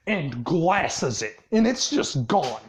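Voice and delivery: plain reading voice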